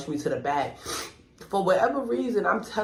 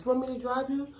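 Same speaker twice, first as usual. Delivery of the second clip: raised pitch concerned mom; high mom voice; 9 semitones higher, 3 dB quieter, faster than usual. Retold or imitated. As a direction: ask it higher, worried. Mom offers to drive.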